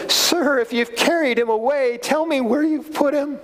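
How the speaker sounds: high, pleading plea to the gardener; pleading Mary. Acted. Mary begging the man she thinks is the gardener, high and pleading. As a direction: beg him to give the body back, voice up high.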